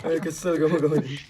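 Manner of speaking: laughing. Real, not acted. Laughing at own failure.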